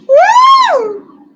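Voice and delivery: loud high whoop of delight; a real, thrilled woo; excited whoop. Real, not acted. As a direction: cheer at the screen. Reacting to dragon scene.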